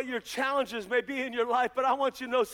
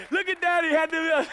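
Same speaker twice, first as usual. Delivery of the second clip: high, loud mocking kids; hammed-up children's jeering; imitated kids; 7 semitones higher, 6 dB louder than usual. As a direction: tease Daddy like his children would. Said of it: "they gonna be like".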